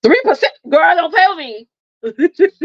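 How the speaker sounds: high, laughing outrage; laughing disbelief. Real, not acted. Mom laughing at her daughter's tiny offer. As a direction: scoff through laughter.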